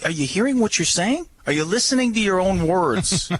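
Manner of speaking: high-pitched, incredulous challenge; retold exasperation. Dog owner challenging stranger on his own words, retold exasperation. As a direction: act out disbelief at someone's foolish logic.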